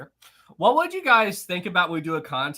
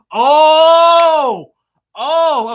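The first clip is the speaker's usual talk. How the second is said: high, much louder burst of surprise; loud surprised oh; 9 semitones higher, 14 dB louder than usual. Real, not acted. Streamer sees a question for Maritime, loud surprised shout. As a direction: shout it out, caught off guard. Reacting to a surprise super chat.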